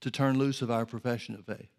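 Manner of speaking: earnest praying aloud to God; fervent prayer. Acted. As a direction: pray it with conviction. Prayer continues.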